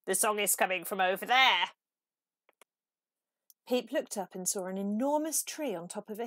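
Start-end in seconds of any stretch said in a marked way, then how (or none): 0.1-1.7 croaking frog. Acted. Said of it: "croaked the frog".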